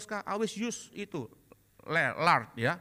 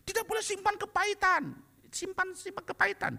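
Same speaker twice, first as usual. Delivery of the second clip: mock preaching rule; 12 semitones higher than usual. Church rule quoted.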